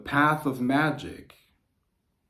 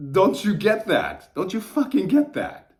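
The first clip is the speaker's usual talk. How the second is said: exasperated outburst; 9 semitones higher, 7 dB louder than usual. Real, not acted. Pleading with viewers to understand, higher and louder. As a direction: hammer it home, pitch up, loud.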